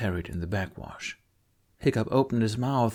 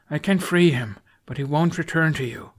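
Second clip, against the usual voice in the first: urgent plea; 6 semitones higher, 4 dB louder than usual. A narrator's reading. Hiccup urging Astrid.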